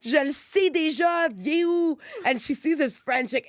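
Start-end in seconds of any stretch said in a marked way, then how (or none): 0.0-2.0 high-pitched mock Québécois rant; Québécois French outburst. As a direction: imitate an irate Quebec woman. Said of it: "She's, like, freaking out".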